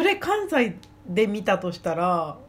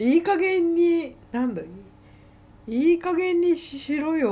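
leisurely scolding; 8 semitones higher than usual. Retold or imitated. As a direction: scold lazily, stretching it out. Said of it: "のんびり".

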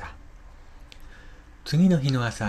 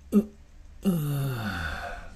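weak drawn-out moan; slow groan from the ground; pained groan; 4 semitones higher, much slower than usual. Acted. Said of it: "何やら谷底の方で声がする". (苦しそうなうめき声)

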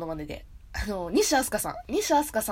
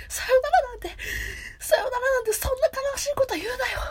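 high, loud, pleading character impression; Ogata; 13 semitones higher, 4 dB louder than usual. Anime hero begging against a sad farewell, high impassioned imitation. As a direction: cry it out high, hammed up. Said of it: "尾形さんの声出した方がいい".

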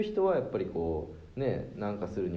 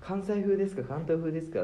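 mimicking canteen lady; 7 semitones higher than usual. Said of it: "って聞いてくんのよ".

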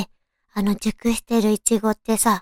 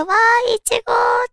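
delighted squeal; 11 semitones higher than usual. Retold or imitated. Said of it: "って感じなんだけど".

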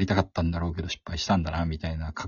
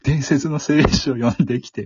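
高く大きな声で、芝居がかって; 冒険物語の口上のように盛り上げる; おどけたナレーション; 7 semitones higher, 6 dB louder than usual. Retold or imitated. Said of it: "みたいな". (高めの大声で大げさに語る)

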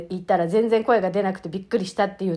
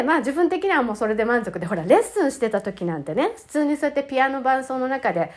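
ordinary delivery

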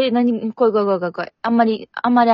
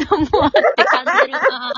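laughing; 6 semitones higher, 5 dB louder than usual. Real, not acted. Talking over nose blowing, laughing.

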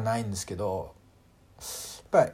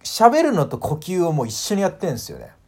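ordinary talk

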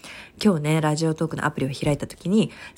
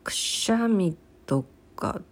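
ordinary talk, nothing marked

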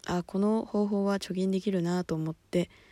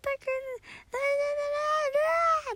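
put-on wailing baby-cry voice, very high; talking while fake-crying; much faster than usual. On a group call copying the crying baby, high wailing cry. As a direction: speak through an imitated infant's wail. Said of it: "私がずっと泣き真似してんの".

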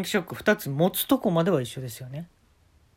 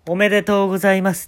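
loud, fast emcee announcement; mock host proclaiming the prize; announcing congratulations; 4 semitones higher, 8 dB louder, faster than usual. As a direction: blare it out like a game-show host. Host congratulates winner, crackers popping.

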